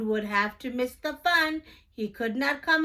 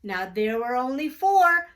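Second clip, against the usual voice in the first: ordinary delivery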